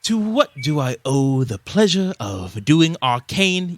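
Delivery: slow, theatrical elvish scholar; inquisitive elvish tinkerer. Acted. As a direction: savor each word like a robed arcanist.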